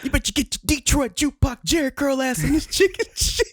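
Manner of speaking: high mock sergeant voice breaking into laughter; movie sergeant quote. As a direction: spit the insult, then laugh through it.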